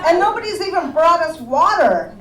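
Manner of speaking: a loud, pushy diner complaining to the waitress; gruff cat customer. Retold or imitated. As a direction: play the bully cat as a rude customer. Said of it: "he's coming down the hallway".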